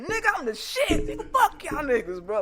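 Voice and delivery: high, loud bragging yell with a laugh; boasting shout. Retold or imitated. On the phone to the radio DJ, a high, laughing brag. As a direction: crow to the radio DJ like you just won. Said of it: "I said".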